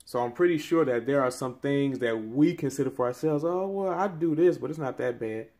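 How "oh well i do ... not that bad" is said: ordinary talk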